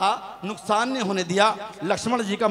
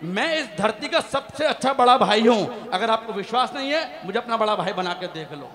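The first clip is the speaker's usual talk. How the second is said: usual speaking voice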